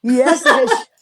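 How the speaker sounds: words broken up by laughter; giggling while retelling the mishap; laughing. Real, not acted. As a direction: laugh your way through the restart. Laughing over her own story.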